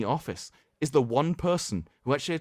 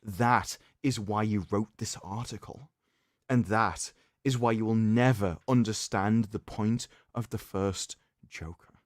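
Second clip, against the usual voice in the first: dramatic reading; 5 semitones lower than usual. Acted. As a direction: deliver it like a grave eulogy. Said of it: "That was a beautiful, dramatic reading".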